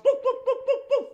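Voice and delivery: high, rapid puppet barks; angry dog barking. Pretend. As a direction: bark at intruders in the yard. Jim Dog barks at squirrels.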